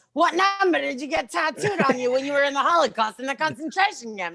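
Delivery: tag. Retold or imitated. Acts out the man's offensive question.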